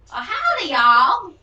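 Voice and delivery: very loud, high Southern greeting; mock Southern howdy; over-exaggerated Southern accent. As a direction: holler it in a cartoonish Southern accent. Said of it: "you do the over-exaggerated".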